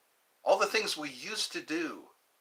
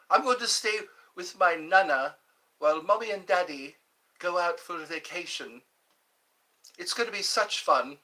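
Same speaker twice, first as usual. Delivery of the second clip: put-on British child's voice; British accent; 3 semitones higher than usual. Child telling of a stay with nana, British accent. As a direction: play an excited little Brit. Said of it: "That sounds very British".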